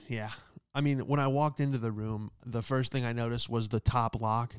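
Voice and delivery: ordinary delivery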